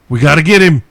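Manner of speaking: mocking police eagerness; mock cop zeal. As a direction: play the gung-ho officer.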